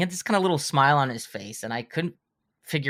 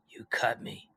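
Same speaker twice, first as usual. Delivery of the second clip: hushed, deadpan imitation of an accuser; whispered accusation; 2 semitones lower, 8 dB quieter, slower than usual. Actor to young writer over a bleeding finger, whispered accusation. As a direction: whisper it slowly as a mock-grave reproach.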